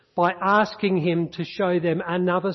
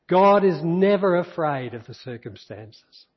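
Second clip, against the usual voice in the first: ordinary delivery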